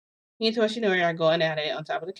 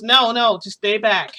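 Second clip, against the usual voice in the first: loud, higher-pitched refusal; urgent warding off; 4 semitones higher, 9 dB louder than usual. Retold or imitated. Toshinori keeps Midoriya from coming inside, urgent warding off. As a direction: call out sharply to stop someone coming in.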